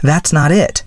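interrupting. A narrator's reading. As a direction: cut in as the young woman. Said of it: "interrupted May".

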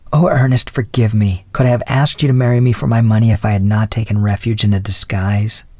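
ordinary delivery, nothing marked

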